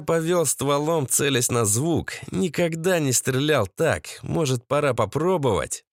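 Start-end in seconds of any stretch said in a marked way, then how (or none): none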